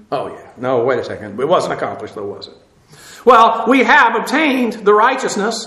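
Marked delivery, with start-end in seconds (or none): 0.1-2.5 quiet wry aside. Real, not acted. Joke about mission accomplished aside.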